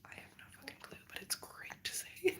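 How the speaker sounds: breathy unvoiced confession; whispered aside. Real, not acted. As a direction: whisper it like a secret to viewers. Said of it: "confiding aside".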